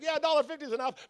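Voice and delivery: high, hurried nervous voice; mock panic. Spooked customer pumping gas at midnight, mock panicky hurry. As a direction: blurt it to get away quickly.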